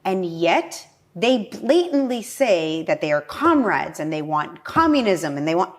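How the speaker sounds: usual speaking voice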